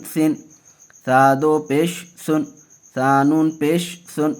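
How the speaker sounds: slow, measured syllable-by-syllable drill; deliberate teaching chant; Qaida spelling recitation. Shown as a demonstration. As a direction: recite each letter and vowel for learners.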